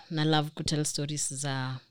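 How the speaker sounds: ordinary talk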